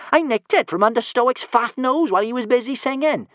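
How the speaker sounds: high, light and careless; breezy. Acted. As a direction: toss it off breezily. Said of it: "said Kamikaze breezily".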